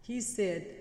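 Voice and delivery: ordinary talk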